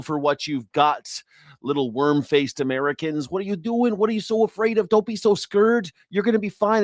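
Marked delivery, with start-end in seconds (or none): 3.3-6.8 high, condescending singsong; mock coddling. Host teases frightened Americans about heat, high mock coddling. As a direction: coo at them as if at scared babies.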